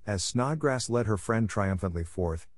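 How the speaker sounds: usual speaking voice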